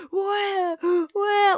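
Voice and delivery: put-on high whiny baby wail; mock ugly cry. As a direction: imitate a big baby crying. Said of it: "ugly cry".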